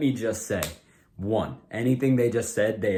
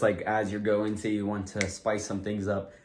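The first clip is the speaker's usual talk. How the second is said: usual speaking voice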